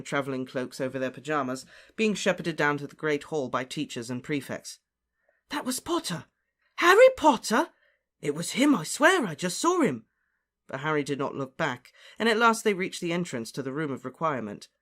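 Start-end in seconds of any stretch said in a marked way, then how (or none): 5.5-10.0 high, astonished student chatter; thrilled, high-pitched insistence; excited exclaiming. Acted. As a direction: gasp out the news in disbelief. Students exclaim at seeing Harry.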